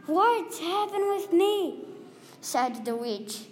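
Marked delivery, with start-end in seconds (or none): 0.1-1.9 shocked cry. Acted. Girl wakes up as witch.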